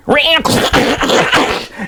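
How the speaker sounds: high, clattering screech of a buffer; imitated runaway machine. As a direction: screech and rattle like a machine going wild. Imitates the buffer going wild.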